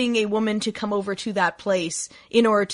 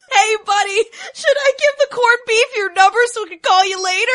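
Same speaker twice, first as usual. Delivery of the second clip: high, loud laughing mockery; laughing taunt; 12 semitones higher, 5 dB louder than usual. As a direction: cackle at him while teasing. Server mocks, laughing.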